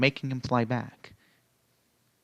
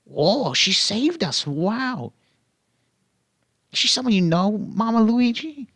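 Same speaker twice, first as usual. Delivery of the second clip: much higher, wide-eyed creature voice; awed Yoshi; 9 semitones higher than usual. Acted. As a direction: gush at the rescuer like a small kid. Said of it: "Yoshi asked".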